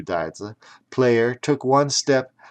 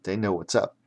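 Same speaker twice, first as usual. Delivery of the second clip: plain reading voice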